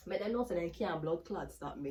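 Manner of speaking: ordinary talk, nothing marked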